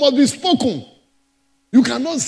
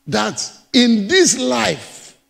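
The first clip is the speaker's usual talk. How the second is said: slow emphasis; much slower than usual. Acted. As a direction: draw out "in this life" very slowly, stressing "this". Preacher repeats phrase for emphasis.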